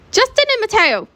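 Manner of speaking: very high-pitched scolding yell; shrieked reprimand; officer's yell. A narrator's reading. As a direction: call out both names like an officer breaking up trouble. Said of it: "Officer Emily yells".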